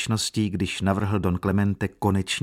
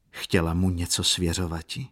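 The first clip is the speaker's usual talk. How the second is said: ordinary delivery, nothing marked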